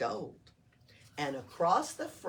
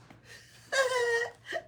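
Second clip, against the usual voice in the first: high, loud elfin laugh; elf giggle; 6 dB louder than usual. Acted. Elves laughing at Oscar, high loud giggle. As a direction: burst out giggling. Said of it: "They exploded into laughter".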